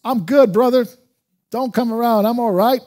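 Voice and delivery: loud, high, cocky mock brush-off; puffed-up, overconfident put-on; arrogant self-confidence. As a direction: act out the brash believer who claims all is well. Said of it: "the arrogance of self-confidence".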